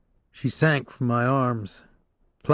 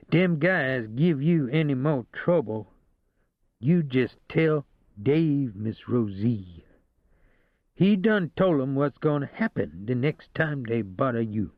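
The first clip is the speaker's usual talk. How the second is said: a slow mock Cajun accent, drawn out; Cajun accent imitation; slower than usual. Rosie mimics the Cajun dispatcher in a slow Cajun accent. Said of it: "She imitated a Cajun accent".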